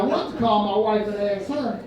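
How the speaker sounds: ordinary talk, nothing marked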